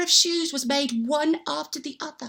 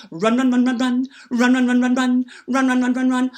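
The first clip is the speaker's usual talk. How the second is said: rhythmic patter; 4 dB louder than usual. Acted. Elves running across floor.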